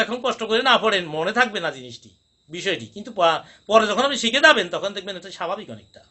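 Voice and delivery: ordinary talk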